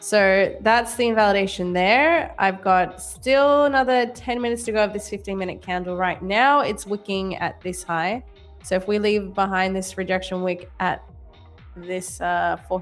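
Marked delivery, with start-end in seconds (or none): none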